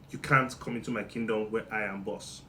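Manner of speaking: ordinary talk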